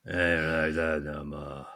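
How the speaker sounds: put-on low, slow grumpy old man; Clint Eastwood. Co-host echoing the film's retired killer, low grumpy impression. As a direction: drawl it low like an old gunslinger. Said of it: "Grumpy old Clint Eastwood".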